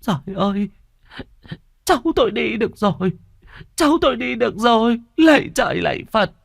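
high, crying with joy; tearful joy. Acted. Grandma hugging walking grandchild, high tearful joyful cry. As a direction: weep and praise heaven at once. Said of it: "ôm con bé Na vào lòng mà khóc nức nở".

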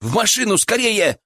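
high-pitched, urgent cry of alarm; scared, urgent order without much extra volume; frightened shout. A narrator's reading. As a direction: call out in fright, voice jumping up. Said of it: "испуганно крикнул ему Илья".

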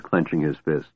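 plain reading voice

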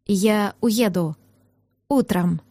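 measured, restrained resignation; trying to calm down. A narrator's reading. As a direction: rein the temper in, speaking slowly. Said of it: "подышал, пытаясь успокоиться".

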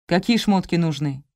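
ordinary delivery

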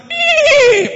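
high squeal of laughter through the word; laughing. Real, not acted. Preacher laughing at the horse comparison, laughing. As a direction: burst out laughing. Laughing at his own joke.

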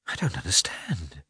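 scared, breathless whisper; a frightened young woman barely voicing it. A narrator's reading. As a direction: whisper it, frightened by what he means. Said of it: "thoroughly frightened".

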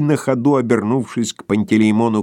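ordinary delivery, nothing marked

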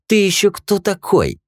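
high, slow mocking voice; sneering thug. Fat thug sneers at the newcomer, high and slow. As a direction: size him up with contempt. Fat man challenges stranger.

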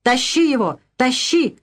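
high angry cries; the women shout to drag him to the ice hole; mob shouting. A narrator's reading. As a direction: egg each other on, rising fury. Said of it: "кричали со всех сторон".

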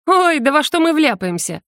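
dismissive scoff, pitched high. A narrator's reading. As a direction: brush it off with a snort. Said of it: "фыркнула я".

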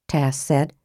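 ordinary delivery, nothing marked

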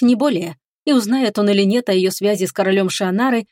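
ordinary delivery, nothing marked

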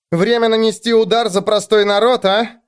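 high, gruff grumble; grumbled. A narrator's reading. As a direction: grumble it like a rebel. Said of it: "буркнула она Теллоу".

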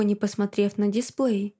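plain reading voice

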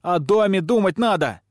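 high, loud retort; objecting. A narrator's reading. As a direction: push back hard. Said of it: "возражает Огнянка". (возмущённо возражая)

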